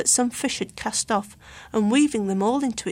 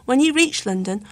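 ordinary delivery, nothing marked

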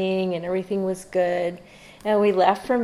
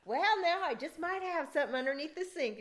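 high, softer put-on voice; imitated Texan friend; 9 semitones higher, 9 dB quieter than usual. Imitating the Texan friend offering hidden bourbon, high coy voice. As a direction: play the coy friend with a secret stash.

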